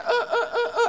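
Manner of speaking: high comic pained yelps; mock yelps. Kid beaten with broom handle.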